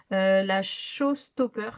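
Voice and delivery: "Showstopper" slow, in halting French-accented English; unsure English. Real, not acted. Naming the palette to viewers, apologising for her English, "Showstopper" slow and hesitant. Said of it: "Je suis désolée pour mon anglais".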